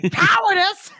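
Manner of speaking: high, loud outraged cry; outraged shriek. Acted. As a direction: shriek it in offended disbelief. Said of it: "Polder loses his shit".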